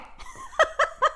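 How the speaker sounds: high quick laugh; a short amused laugh trailing down; light laugh. Real, not acted. Host laughs as guest dodges singing, short high laugh.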